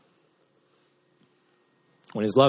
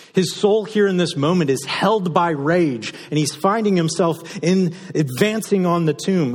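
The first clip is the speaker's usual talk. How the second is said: louder, higher preaching at full force; fiery preaching; 7 semitones higher, 6 dB louder than usual. Acted. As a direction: preach it with mounting fire. Preaching with rising intensity.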